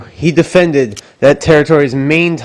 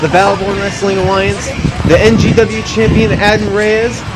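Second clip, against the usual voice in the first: high, hyped ring announcer voice; wrestling promo; 6 semitones higher than usual. Acted. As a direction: sell it like a big fight night.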